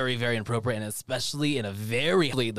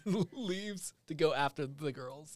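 ordinary delivery

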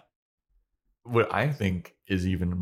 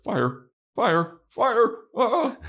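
mock panic, climbing very high and louder; panicked Xander; 5 dB louder, much slower than usual. Xander as the books catch fire, in high hammed-up panic. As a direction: shriek it like he's about to burn. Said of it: "And Xander's like".

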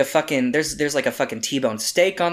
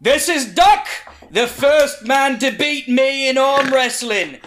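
shouted proclamation; 12 semitones higher, 7 dB louder than usual. Acted. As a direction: bellow it to a whole tavern. Beaten wrestler announces winner to bar.

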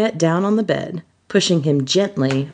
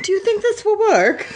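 very high, timid question; tentative, high-pitched uncertainty. Acted. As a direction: ask it in a small high voice. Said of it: "he asked timidly".

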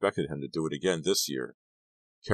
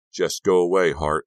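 loud, menacing order; 3 semitones higher, 8 dB louder than usual. A narrator's reading. Teammate blocking Kerry's way, menacing. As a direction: warn him off with force. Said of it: "O'Brien said menacingly".

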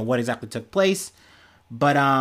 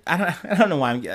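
ordinary talk, nothing marked

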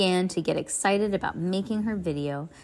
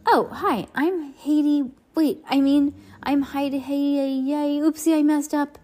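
nervous on camera; 7 semitones higher, 5 dB louder than usual. Acted. Heidi flubbing on camera.

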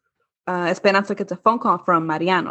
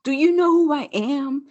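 mock-entitled, pitched way up; Karen; 8 semitones higher than usual. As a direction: pull rank like an outraged Karen. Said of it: "pulls the ultimate Karen move".

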